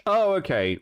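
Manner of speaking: usual speaking voice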